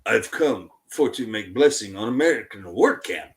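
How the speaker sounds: low, put-on foreign accent with broken English; [name]. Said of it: "And [name] goes".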